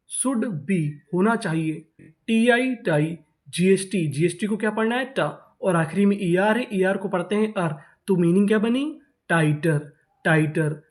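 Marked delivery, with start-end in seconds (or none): none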